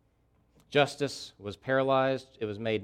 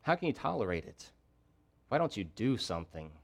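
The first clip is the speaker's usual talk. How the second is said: softer, despairing; 4 semitones lower, 8 dB quieter than usual. Acted. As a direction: drop to a soft, weary plea. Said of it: "But he's saying".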